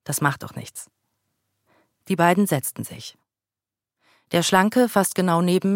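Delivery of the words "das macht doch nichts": soft, smiling and friendly; friendly reply. A narrator's reading. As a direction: brush it off kindly with a smile. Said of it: "Ich lächelte und sagte freundlich". (freundlich und leise lächelnd)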